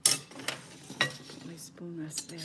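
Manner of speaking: slow careful whisper. Real, not acted. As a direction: whisper it slowly while spooning. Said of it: "I don't know why I'm being quiet".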